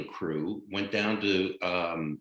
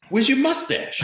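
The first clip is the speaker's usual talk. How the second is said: surprised director; 5 dB louder than usual. Retold or imitated. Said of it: "the first thing the director said to me was".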